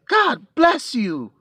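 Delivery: high, loud, slow cry of pity; loud high imitation of a shocked woman; shocked lady's exclamation. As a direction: cry out like a startled stranger blessing him. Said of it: "That's what she said".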